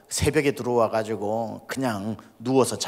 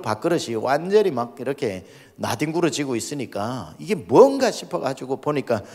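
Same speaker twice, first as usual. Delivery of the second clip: ordinary talk, nothing marked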